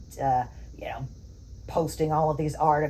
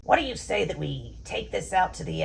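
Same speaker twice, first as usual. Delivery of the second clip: ordinary delivery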